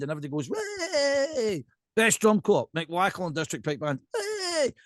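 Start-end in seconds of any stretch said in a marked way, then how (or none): 0.5-1.6 a high, airy crowd "Way!"; mock crowd cheer. Everybody cheers the winner. 4.1-4.7 "Way!" thrown up very high; mock crowd cheer.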